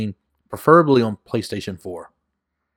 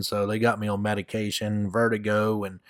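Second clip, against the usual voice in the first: usual speaking voice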